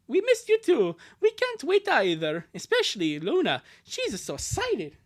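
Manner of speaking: hint of an eager comic character; lightly voiced friendly reply. Answering a friend who missed them, lightly acted eagerness. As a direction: lightly play a character sharing the excitement.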